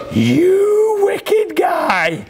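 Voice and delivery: a jeering inner demon; menacing accusing voice. Acted. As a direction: sneer like a gloating tormentor. Inner voice condemning, mock.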